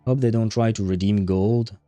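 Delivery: ordinary talk, nothing marked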